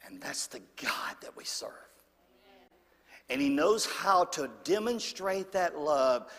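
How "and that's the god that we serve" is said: hushed whisper of awe; soft awed whisper to the congregation. Real, not acted. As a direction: drop to a reverent whisper. Quiet climax of sermon.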